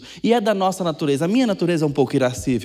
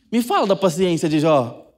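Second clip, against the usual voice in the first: usual speaking voice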